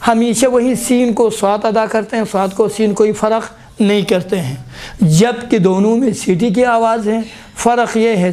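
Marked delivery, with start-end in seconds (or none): none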